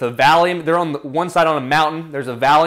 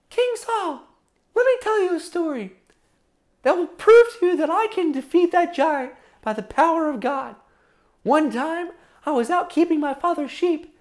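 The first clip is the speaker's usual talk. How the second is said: David; 10 semitones higher than usual. Acted. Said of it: "David says".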